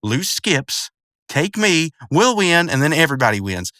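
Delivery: upset cartoon character. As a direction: make an urgent, upset case. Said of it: "Rigby's upset, and he's like".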